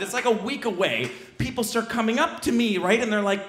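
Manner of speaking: ordinary talk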